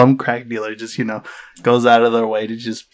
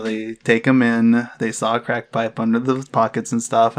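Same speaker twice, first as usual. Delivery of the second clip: usual speaking voice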